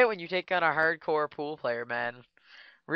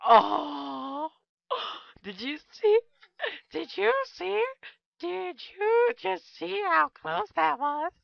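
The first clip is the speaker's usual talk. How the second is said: much higher, gasping squeal of amazement; can you believe it, squeaked high; gasp, then high, slow squeals of disbelief; excited disbelief; 13 semitones higher, much slower than usual. Real, not acted. Near miss on the table.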